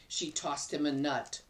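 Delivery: ordinary delivery, nothing marked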